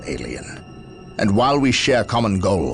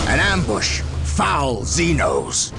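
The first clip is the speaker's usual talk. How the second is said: high-pitched warning cry; alarmed soldier's battle cry; 8 semitones higher, slower than usual. Acted. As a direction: cry out at an ambush, drawing out the curse. Battle cry on being ambushed.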